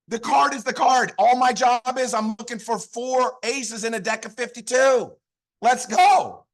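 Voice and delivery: high-pitched, forceful rallying delivery; pumped up. Real, not acted. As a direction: drive each point home with real excitement. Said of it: "I'm so pumped up".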